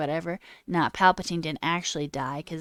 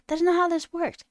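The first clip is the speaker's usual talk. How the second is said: loud, higher outraged shout; exasperated shout; 11 semitones higher, 5 dB louder than usual. Real, not acted. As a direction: blast it out in outrage. Rant, whispered then shouted.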